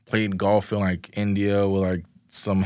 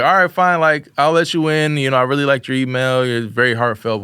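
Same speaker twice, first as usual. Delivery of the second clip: higher and louder, teasing imitation of the teacher; mock teacher giving in; 5 semitones higher, 6 dB louder than usual. Retelling how the teacher let him intern, hammed-up higher and louder. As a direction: play the teacher grudgingly letting him in, raised and loud.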